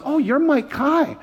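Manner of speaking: put-on high, drawn-out child's voice; little kid. Little boy looking up at the youth pastor, high kid voice. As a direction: imitate a small boy recognizing someone. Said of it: "he goes".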